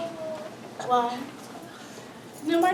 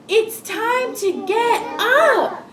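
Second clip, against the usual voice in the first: high, raised wake-up call; storybook shout; 11 semitones higher, 4 dB louder, much slower than usual. Acted. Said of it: "shouted Missus".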